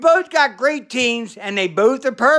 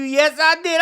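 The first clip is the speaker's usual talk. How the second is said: ordinary delivery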